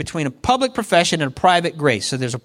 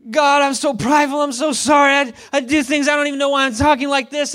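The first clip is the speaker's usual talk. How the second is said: Paul as a hammed-up penitent, higher voice; mock humble Paul; 5 semitones higher than usual. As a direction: play him as falsely contrite, with a little shout on God. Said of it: "Paul's like".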